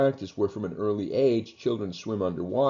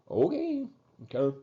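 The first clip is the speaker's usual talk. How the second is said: a sudden much higher okay, then a clipped second one; mock resigned okay; 12 semitones higher than usual. Lecturer voicing someone giving in, high mock okay. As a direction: toss it off like a shrug. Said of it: "It's like".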